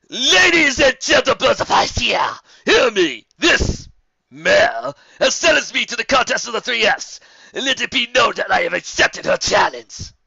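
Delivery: loud, slow herald's cry; booming proclamation. A narrator's reading. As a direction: proclaim to the whole crowd. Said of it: "shouted in a booming voice".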